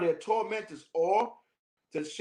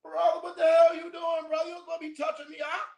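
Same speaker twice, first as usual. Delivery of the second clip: offended senior yells at the junior, mock outrage; outraged senior; 11 semitones higher than usual. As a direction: yell it like an offended elder. Senior outraged at being touched.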